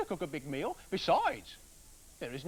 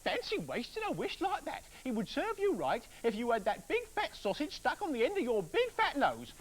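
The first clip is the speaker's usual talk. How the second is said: furious wife; 11 semitones higher, 3 dB louder than usual. Acted. Furious wife rounds on her husband for blaming her, high and loud. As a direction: turn his blame back on him, furious. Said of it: "she said".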